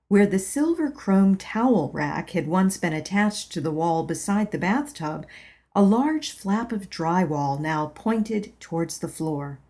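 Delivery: ordinary delivery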